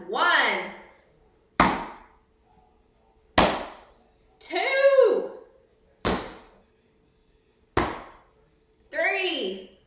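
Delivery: loud coach count-off; shouting counts. Real, not acted. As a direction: call each count out loud.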